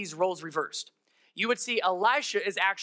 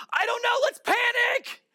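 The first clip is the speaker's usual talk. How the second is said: very high, slow shouted panic; mock panic; 4 dB louder than usual. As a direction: shriek it like a prophet losing his head. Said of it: "freaks out".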